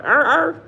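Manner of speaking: short barked er, er; mock dog bark. Mocking a name that sounds like barking, barked. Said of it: "sound like a dog bark".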